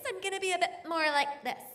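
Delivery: sit tall and lift the voice; sitting up straight voice. Shown as a demonstration. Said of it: "if I'm sitting like this".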